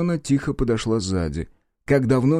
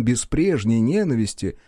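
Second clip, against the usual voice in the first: usual speaking voice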